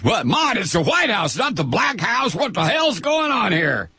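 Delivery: put-on a touch of a scandalized old man; mock outraged bigot.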